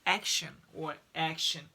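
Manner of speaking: action said clearly with the sh sound in the middle; action modelled. Shown as a demonstration. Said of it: "or this".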